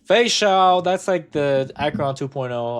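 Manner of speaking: ordinary talk, nothing marked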